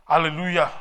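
loud joyful praise cry; shouted hallelujah. Real, not acted. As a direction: call out hallelujah loudly.

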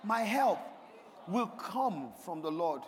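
deliberate, slowing declaration; like you mean it. Shown as a demonstration. Preacher coaching the congregation to repeat the psalm, like they mean it. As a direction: say it with weight, drawing out "will come from the Lord". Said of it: "Say it like you mean it".

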